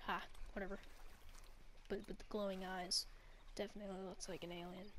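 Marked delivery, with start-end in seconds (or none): none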